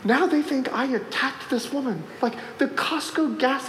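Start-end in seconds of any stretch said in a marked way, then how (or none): none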